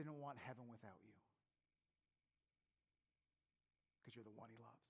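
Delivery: soft, low, intimate assurance; low and quiet, with long pauses; hushed preaching. Real, not acted. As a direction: drop right down and reassure them tenderly.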